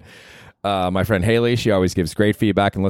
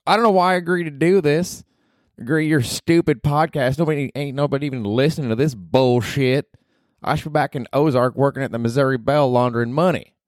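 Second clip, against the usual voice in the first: put-on higher, sneering Southern girl; Ruth from Ozark; 7 semitones higher than usual. Lightly voiced Ruth complaining about doing his podcast. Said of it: "Ruth from Ozark".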